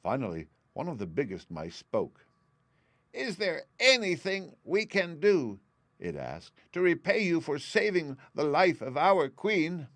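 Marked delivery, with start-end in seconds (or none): 3.1-5.6 high, slow mouse voice; big mouse, eager to help. A narrator's reading. 6.7-9.9 big mouse, eager to help. A narrator's reading.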